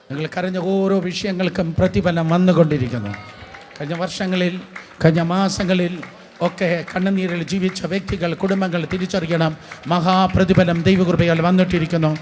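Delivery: loud preaching on a level pitch; insistent, projected proclamation; forceful preaching. Real, not acted. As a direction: declare the promise with force.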